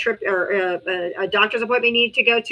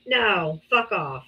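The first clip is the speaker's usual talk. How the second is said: ordinary delivery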